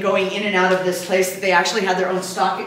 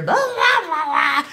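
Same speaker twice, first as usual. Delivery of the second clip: mock chatter; 8 semitones higher, slower than usual. Mimicking drunk group chatter.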